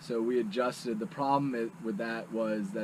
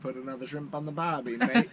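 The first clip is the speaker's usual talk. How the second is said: put-on Australian accent; 4 semitones higher than usual. [name] mocking Australians for friends, hammed-up Aussie accent.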